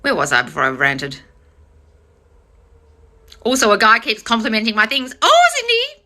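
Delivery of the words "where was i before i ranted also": ordinary talk, nothing marked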